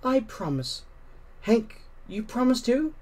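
high, earnest little promise; earnest girl voice. A narrator's reading. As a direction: plead it sincerely. Susan's voice.

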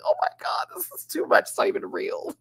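very high, squeaky retold freak-out; mock panic. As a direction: act out losing it, voice way up high. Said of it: "I was over there losing it".

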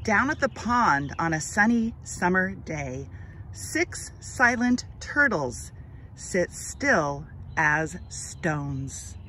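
put-on slow, expressive read-aloud for children; gentle storybook narration, drawn out; story narration. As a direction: read it like a picture book to little kids, lingering on each line. Reads picture book aloud.

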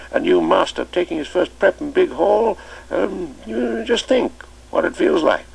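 put-on explaining he was a young master then; amused old master. Chips amused protest.

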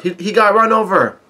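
high-pitched mock yell; retold yell. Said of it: "the Big Mom pirates yell out".

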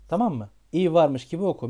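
ordinary talk, nothing marked

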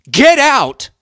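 enraged scream, very high and loud. A narrator's reading. Richard orders Rob out of the house, screaming. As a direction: scream the order at full force. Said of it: "Richard was screaming".

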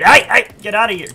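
loud, high, urgent yell; urgent shout. Real, not acted. Player spots an opponent while talking, urgent shout. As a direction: shout to chase someone off.

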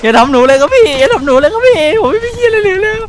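mock begging. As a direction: beg for mercy like a scared kid. Begging enemy in mock fear. (แกล้งอ้อนวอนขอชีวิต เสียงสูง)

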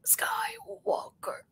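stammering, drawn-out whisper of a dying man. Retold or imitated. Prime minister dying, faint stammering whisper. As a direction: gasp out the name with your last breath. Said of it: "And then he says".

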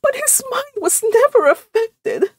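very high, weepy insistence; tearful. A narrator's reading. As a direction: defend him tearfully in a high voice. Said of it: "admitted Missus Frederick tearfully".

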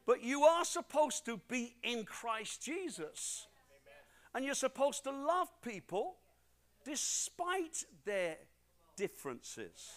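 drawn-out, weighty words; slow down and land each word; slow emphatic preaching. Acted.

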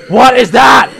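high, loud alarmed question; panicked shout. Real, not acted. Gamer spots something frightening, panicked shout.

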